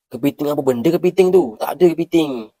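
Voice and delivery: baffled shopkeeper. Acted.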